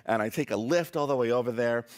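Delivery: usual speaking voice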